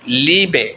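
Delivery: لیبے with a long stretched ee, as Liebe; German long i. Shown as a demonstration. Teacher demonstrating German Liebe, long ee sound. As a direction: draw out the ee in لیبے. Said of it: "لمبی آواز".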